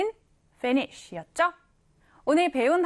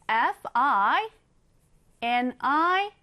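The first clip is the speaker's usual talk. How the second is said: normal talking voice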